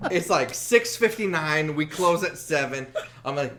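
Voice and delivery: ordinary talk, nothing marked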